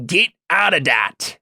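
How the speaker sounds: drawn-out, high-pitched repeat for the slow listener; exasperated Git. Acted. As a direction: spell it out slowly, fed up. Git repeats after rolling his eyes.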